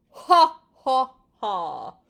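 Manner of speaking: very loud, hammed-up booming laugh; terrible giant laugh. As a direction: roar out an even bigger laugh. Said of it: "laughed an even terribler laugh".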